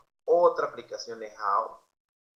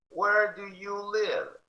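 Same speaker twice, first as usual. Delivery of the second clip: Where do you live slow and much higher; slow high model; 9 semitones higher, 3 dB louder, much slower than usual. Shown as a demonstration. Teacher modelling for students, slow and high. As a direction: sing out the question slowly. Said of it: "model slow high".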